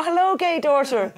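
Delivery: high, loud singled-out hello; imitating parents' hammed-up welcome; mock cheery greeting. Parents answering daughter's phone call, mock cheery.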